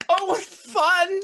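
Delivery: high, loud, drawn-out thrilled squeal; delighted high-pitched cheer; gleeful whoop. Acted. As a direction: whoop it out like you love the ride. Game master voices gleeful Madrigal.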